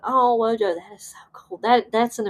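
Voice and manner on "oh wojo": usual speaking voice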